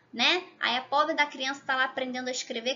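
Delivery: ordinary talk, nothing marked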